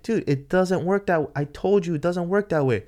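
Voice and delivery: high-pitched exasperated insistence; insistent arguing. Retold or imitated. Driver telling official it can't be done, exasperated.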